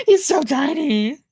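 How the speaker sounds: slow, cooing over something tiny. Real, not acted. Cooing at tiny sick sticker.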